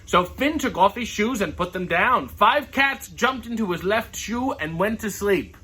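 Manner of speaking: lively read-aloud voice, characters lightly voiced; story reading. Reading a picture book to viewers, lively storytelling.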